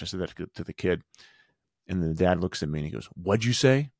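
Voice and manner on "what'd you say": mock offended, higher. Offended dad confronting the coach, imitating offended tone. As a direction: imitate an affronted dad challenging him. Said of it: "Like, really offended".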